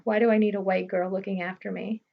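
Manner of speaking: usual speaking voice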